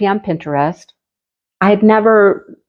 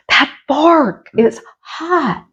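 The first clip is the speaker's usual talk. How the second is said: mock pained whine; 7 semitones higher, much slower than usual. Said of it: "And I'm like".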